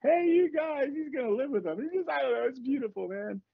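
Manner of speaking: high, choked-up blubbering imitation; mock tearful. Crying dad explains the Goonies to his daughter, mock tearful. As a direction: gush through pretend tears in a high voice. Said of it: "Dad, why are you crying?".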